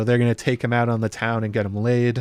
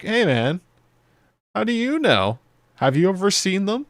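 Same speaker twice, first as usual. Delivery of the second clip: higher, playful dodge; cocky con man; 9 semitones higher than usual. Retold or imitated. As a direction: wave off the doubt like a smooth talker. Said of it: "And Ulf goes like".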